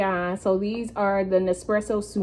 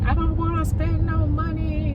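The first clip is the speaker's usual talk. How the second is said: inner voice; 7 semitones higher than usual. Retold or imitated. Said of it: "I was like".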